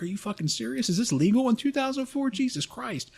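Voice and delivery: high-pitched incredulous outburst; retold disbelief. Recruit reacting to the instructor's abuse, high incredulous protest.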